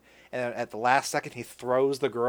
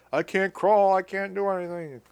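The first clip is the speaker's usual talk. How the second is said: higher-pitched put-on plaint; mock helplessness; 6 semitones higher than usual. Injured man stuck on the dam, mock helpless. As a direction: imitate someone giving up in protest.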